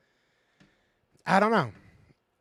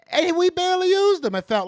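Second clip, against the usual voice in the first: higher, louder complaint about barely using him; heated higher rant; frustrated outburst; 10 semitones higher, 7 dB louder than usual. Real, not acted. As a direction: fire it off in frustration. Frustrated about Swift's touches.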